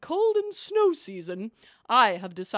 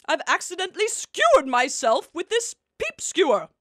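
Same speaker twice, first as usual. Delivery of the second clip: flustered; 5 semitones higher, 6 dB louder than usual. Acted. Reports pricking herself.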